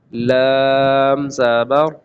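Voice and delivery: each letter stretched very slowly; drawn-out spelling. Shown as a demonstration. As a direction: spell it out slowly and clearly, like a reading teacher. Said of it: "آپ جوڑ اور رواں بھی کر سکتے ہیں".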